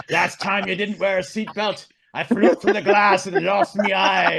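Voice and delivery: drawn-out pirate yarn voice; hammed-up pirate; pirate Lyft driver. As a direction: tell it like an old sea dog. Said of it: "a pirate Lyft driver".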